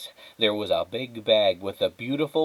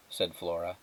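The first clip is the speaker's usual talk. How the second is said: ordinary delivery, nothing marked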